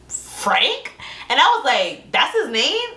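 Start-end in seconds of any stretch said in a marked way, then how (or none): 0.1-0.9 high-pitched surprised question; incredulous. Retold or imitated. Retelling her cousin asking who said it, incredulous. 2.1-2.9 high, incredulous question. Retold or imitated. Retelling her reply on learning his name, incredulous.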